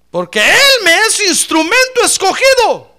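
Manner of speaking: high, loud preacher's shout; shouted pulpit reading, pitched high; thunderous scripture proclamation. Acted. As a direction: declaim God's words at full force. Preacher proclaims God's words in scripture.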